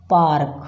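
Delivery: पार्क with a half r, paar-k; the right पार्क, not पारक; correct पार्क. Shown as a demonstration. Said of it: "अगर र लगा दिया तो पारक हो जाएगा".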